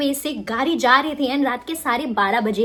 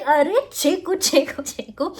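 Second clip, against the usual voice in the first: excited; 5 semitones higher than usual. Retold or imitated. Quoting herself cheering the song.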